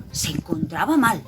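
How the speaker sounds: usual speaking voice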